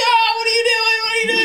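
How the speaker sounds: high-pitched screaming mother, hammed up; horrified joke-telling scream; mock scream. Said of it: "and she's screaming".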